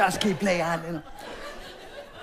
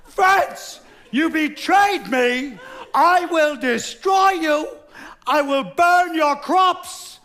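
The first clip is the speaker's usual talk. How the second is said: put-on very loud, much higher, slow drunken roar of rage; drunken yelling; 10 semitones higher, 6 dB louder, much slower than usual. Drunk England yelling across the Channel at France, raging and loud. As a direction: bellow it across the water at her. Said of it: "yelling across".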